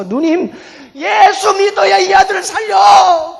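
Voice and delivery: loud, high, drawn-out urging; urgent retold plea. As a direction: cry out a desperate appeal to your sister. Acted urgent plea to his sister.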